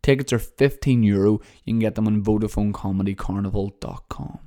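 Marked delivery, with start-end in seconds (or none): none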